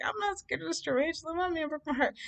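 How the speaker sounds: high sassy boast; super sassy. Retold or imitated. Showing off to the ex with a tarantula, high sassy boast. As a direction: toss it off proudly, chin up. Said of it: "I was being super sassy".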